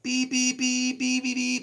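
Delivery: high beep beep horn imitation; honking through the crosswalk; mock horn. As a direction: chant it like a horn. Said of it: "I'm like".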